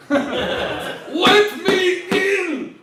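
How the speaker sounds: high, drawn-out Russian bride cry; mock Russian bride yelling. Comic's Russian bride demands entry, long high drawn-out yell. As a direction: stretch it out like a monstrous bride begging. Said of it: "That's the mail order bride".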